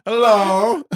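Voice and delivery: high, loud, drawn-out hello; mock Borat greeting. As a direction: greet a whole country in a hammed-up Borat voice. Acting Borat testing his microphone.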